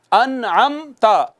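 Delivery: very slow अनअमता with clear meem; slow recitation. Shown as a demonstration. Teacher reciting the word to show izhar. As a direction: draw out अनअमता carefully. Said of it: "अन के नून पे भी इजहार होना है".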